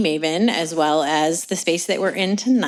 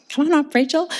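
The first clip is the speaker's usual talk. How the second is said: higher, lively invitation to come up; calling up; 9 semitones higher, 3 dB louder than usual. Real, not acted. Host beckons the poet up to read, calling out. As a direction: call a guest up to the front. Host calls reader up to stage.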